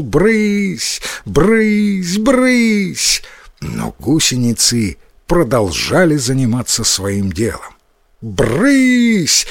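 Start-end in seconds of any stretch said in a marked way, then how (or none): 0.0-3.2 high, drawn-out storybook cry, not very loud; mock loud shout. A narrator's reading. Boy shooing caterpillars off the cabbage, mock shouting. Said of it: "громко закричал". 8.2-9.5 high, long cry without real volume; mock full-strength shout. A narrator's reading. Boy yells at caterpillars that ignore him, contained storybook shout. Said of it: "закричал Йотаро, что было мочи".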